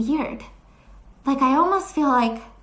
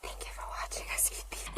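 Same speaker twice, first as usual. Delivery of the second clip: soft voiceless ASMR whisper, quick; breathy close-mic whisper sign-off; 18 dB quieter, much faster than usual. Retold or imitated. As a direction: whisper it like closing an ASMR video. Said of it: "'cause it was a whispering one".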